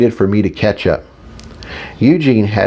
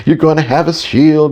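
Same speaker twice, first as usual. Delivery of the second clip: higher, excited squeal back; 6 semitones higher than usual. A narrator's reading. Analytical side celebrates the shield, squealing back higher. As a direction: echo the news in a high mild squeal. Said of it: "he squealed back".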